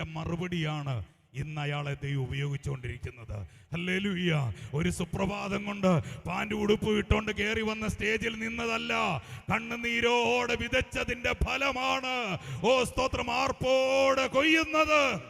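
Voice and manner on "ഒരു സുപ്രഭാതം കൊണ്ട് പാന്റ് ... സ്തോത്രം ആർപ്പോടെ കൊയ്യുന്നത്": preaching that builds to a loud, high cry; fervent preaching. Real, not acted. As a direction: drive the sermon up, stretching the last words. Preaches with rising force. (ആവേശത്തോടെ ഉയരുന്ന പ്രസംഗം)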